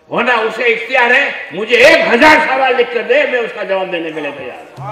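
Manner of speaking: loud, high challenge to the rival sect; fiery preaching. Acted. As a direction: thunder it out from the pulpit, daring them to debate. Preaching at full force. (ऊँची आवाज़ में जोशीला was)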